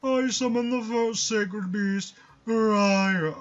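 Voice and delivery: slow, grand pompous villain. Pretend. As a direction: boom it out like a self-important master. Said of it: "pompous voice".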